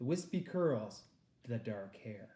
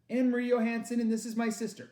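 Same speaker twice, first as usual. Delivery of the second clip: put-on girl's voice; 9 semitones higher, 6 dB louder, faster than usual. Girl answers officer.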